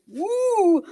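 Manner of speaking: high playful hoot imitating the melody; mock high hoot; hooting woo. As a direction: toss it up high like a sung note. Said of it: "And they go".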